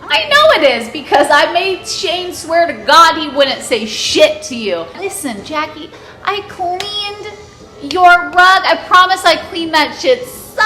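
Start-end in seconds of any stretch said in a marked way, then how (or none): none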